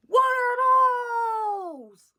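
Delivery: loud, high drawn-out shout; mock heckler yell. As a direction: holler it out like a heckler, stretching the words. Retold heckler yelling at comedian.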